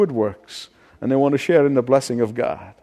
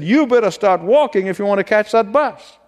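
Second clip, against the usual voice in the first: a half-hearted yell, higher and a bit louder; mild yell; 7 semitones higher, 3 dB louder than usual. Retold or imitated. Old man half-yelling at the stubborn boy. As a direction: snap at the kid to get moving. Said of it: "he kinda yelled at him a little bit".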